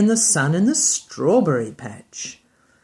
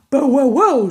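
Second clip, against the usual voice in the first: louder, higher barks; a dog barking up a tree; 7 semitones higher, 7 dB louder than usual. Acted. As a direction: bark it out. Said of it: "said Bitzer Maloney".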